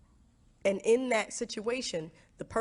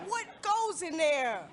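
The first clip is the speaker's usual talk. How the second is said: amazed question; 9 semitones higher, slower than usual. Retold or imitated. Reacts to fiance's baggage.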